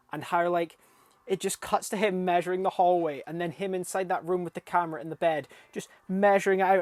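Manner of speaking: racing through the funny bit; excited. Real, not acted. Excited recap of funny scene.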